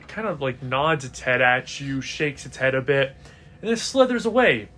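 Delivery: usual speaking voice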